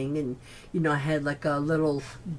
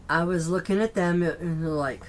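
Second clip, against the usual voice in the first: usual speaking voice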